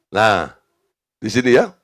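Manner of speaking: ordinary delivery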